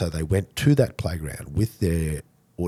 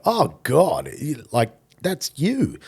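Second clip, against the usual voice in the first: loud, high-pitched burst of recognition; excited recognition; 10 semitones higher, 7 dB louder, much slower than usual. Retold or imitated. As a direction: imitate an amazed fan, high and loud. Fans recognise his voice, retold.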